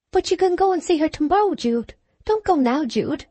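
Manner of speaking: high plaintive plea, a touch of it; plaintive pleading. A narrator's reading. Sue pleading with Jude from the doorway, plaintive. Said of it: "came in plaintive accents from the doorway".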